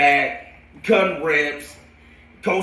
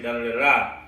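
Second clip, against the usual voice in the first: ordinary delivery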